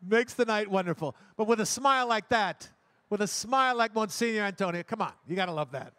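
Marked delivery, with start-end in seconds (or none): none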